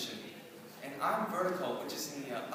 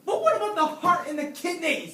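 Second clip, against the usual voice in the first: loud, raised-pitch challenge; insistent objection; 6 semitones higher, 10 dB louder than usual. Acted. Student challenges Frankenstein in class, loud and insistent. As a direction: push back loudly at the professor.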